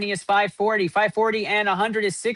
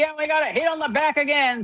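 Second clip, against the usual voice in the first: excited call; 6 semitones higher than usual. Real, not acted. Host spots another hit.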